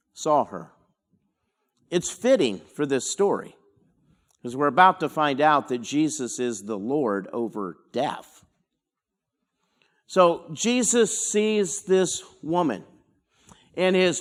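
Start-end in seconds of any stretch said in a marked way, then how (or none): none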